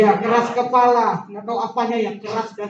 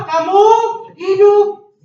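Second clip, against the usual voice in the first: louder, higher call; proclaimed; 6 semitones higher, 7 dB louder, slower than usual. Real, not acted. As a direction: proclaim it to the congregation. Preacher proclaims again. (diserukan lebih keras)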